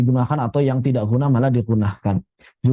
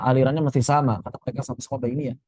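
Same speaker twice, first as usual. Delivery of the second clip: ordinary delivery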